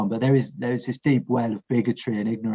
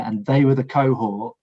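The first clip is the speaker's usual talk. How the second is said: usual speaking voice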